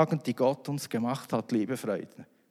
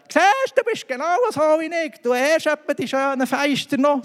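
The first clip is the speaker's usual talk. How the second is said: much higher, louder mocking voice; gloating retort; 14 semitones higher, 7 dB louder than usual. To Jesus in his head, a gloating high mock. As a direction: crow it back like a told-you-so. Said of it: "Dann habe ich zuerst so gedacht".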